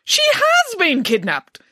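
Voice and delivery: high, loud, slow cry of alarm; alarmed nanny jumping to the worst conclusion; panicked exclamation. A narrator's reading. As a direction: exclaim it in panic, stretching the words. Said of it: "started to panic".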